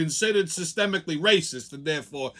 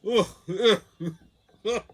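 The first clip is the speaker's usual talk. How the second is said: gagging ugh sounds; repeated grossed-out ugh; disgusted groans; 3 semitones higher than usual. Real, not acted. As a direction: groan like smelling something foul. Man covered in filth.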